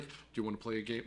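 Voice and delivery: low, hushed and rushed horror-movie creep; mock creepy killer on the phone; creepy villain. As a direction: murmur it fast like a phone stalker.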